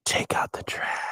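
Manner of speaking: soft, unvoiced spooky-joke whisper; be the wind whispering a chore. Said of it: "the wind is whispering warm weather or any words".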